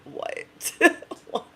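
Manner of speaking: breathless whispered what; whispered surprise. Retold or imitated. Speaker stunned at her friend, whispered disbelief. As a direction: gasp it out in a whisper. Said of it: "I'm like".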